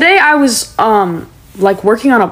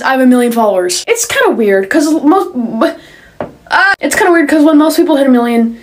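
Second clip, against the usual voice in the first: usual speaking voice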